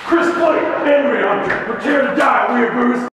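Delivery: battle cry, high and loud; shouting. Acted. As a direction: yell it as you charge in. Raid, shouting at targets.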